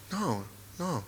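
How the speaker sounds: hushed, shocked plea; stunned denial. Retold or imitated. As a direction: refuse it in a small voice. Retold reaction to hearing he's gone.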